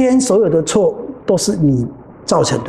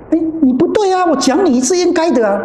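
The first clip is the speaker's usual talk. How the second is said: much higher, self-righteous protest; indignant retort; 11 semitones higher than usual. Retold or imitated. Younger brother snaps back at the teacher, indignant and high. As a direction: throw it back defiantly, pitched high.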